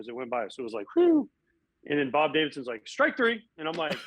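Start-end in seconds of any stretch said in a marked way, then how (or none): 0.5-1.3 high, loud whoosh of a ball flying past. Retold or imitated. Batter imitating the ball whizzing past his shirt. 2.9-3.4 high, loud mock umpire strike call. Umpire ringing up the batter in a mock call.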